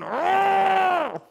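high, drawn-out cry of frustration; imitated kid's exasperated wail; acted high frustrated yell; frustrated scream. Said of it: "getting so angry, so frustrated".

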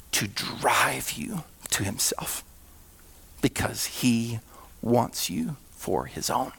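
slow, soft, near whisper; low hushed preacher's plea; tender hush. Acted. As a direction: lean in and plead gently with them. Soft intimate appeal to listeners.

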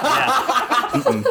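real laugh breaking through; laughing hard, barely getting words out. Real, not acted. Host cracks up at accent.

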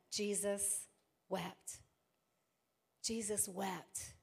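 Jesus wept said softly and slowly, almost breathed; hushed and slow. Acted. Pastor lingering over Jesus weeping, hushed and slow. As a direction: let the words sink, soft and slow.

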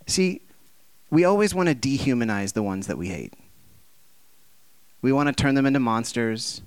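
ordinary talk, nothing marked

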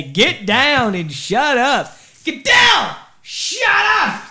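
imitated army yell, high and loud; slow drawn-out order building to a full shout; Lieutenant Dan yelling. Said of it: "Lieutenant Dan used to yell at us and say".

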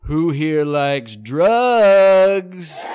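loud, very slow shouted question; comic rephrasing to win the room; crowd-work shout. Acted. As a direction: draw it out to the crowd. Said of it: "Let me rephrase it".

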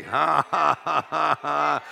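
loud, steady forced ha-ha; defiant laughing. Acted. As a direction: laugh in the devil's face. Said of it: "He said".

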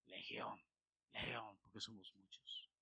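breathy eerie demon murmur. Retold or imitated. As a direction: hiss out the name like a demon. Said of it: "ya me imagino ese demonio".

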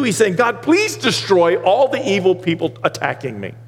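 high, rising pulpit voice, slowing on key lines; full-force preaching. Acted. Pastor preaching Psalm 139 to his church, full force. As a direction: preach it out to the congregation.

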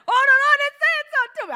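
high, loud and fast gush; bursting with excitement. Retold or imitated. Preacher acting a believer eager to share, high loud fast gush. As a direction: blurt it out thrilled. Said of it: "You're like".

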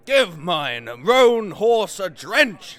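slow, loud mock soldier; mock Hotspur. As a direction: bark the order hammily. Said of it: "says he".

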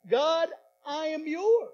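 loud, slow, high "God, I am yours". Shown as a demonstration. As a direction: proclaim it with all your heart. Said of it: "I want you to say".

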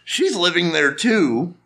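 ordinary talk, nothing marked

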